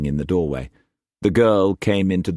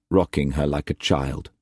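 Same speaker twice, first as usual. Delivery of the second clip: ordinary delivery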